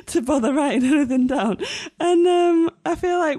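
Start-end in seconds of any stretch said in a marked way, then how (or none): 0.1-1.6 higher, giggling through the words; laughing admission. Real, not acted. Podcaster confessing to co-hosts about notes, laughing. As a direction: admit it with a laugh.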